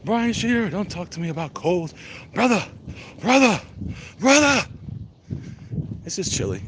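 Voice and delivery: high, loud mock outburst building on each word; over-the-top playful shouting; hammed-up boast. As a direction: holler it like a wrestler, hammed up.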